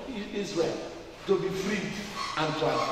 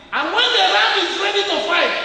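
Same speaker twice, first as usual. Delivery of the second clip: much higher and louder build-up; rising excitement; 11 semitones higher, 7 dB louder, faster than usual. Real, not acted. Preacher building up the ram story, higher and louder. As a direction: swell up with the image.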